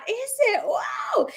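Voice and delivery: very high-pitched wow; high, delighted surprise; amazed. Real, not acted. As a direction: react with squealing amazement. Reacts to listener's red hair news.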